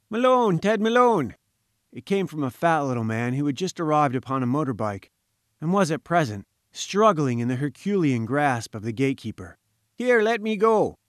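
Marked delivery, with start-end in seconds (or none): 0.1-1.4 high, loud plaintive call; plaintive bleat. A narrator's reading. Visitor calling to Malone from behind, plaintive bleat. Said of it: "we heard a plaintive bleat". 9.9-11.0 high, slow protest from a man held fast; sputtering protest. A narrator's reading. Visitor struggling in the gatekeeper's grasp, sputtering protest. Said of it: "he sputtered".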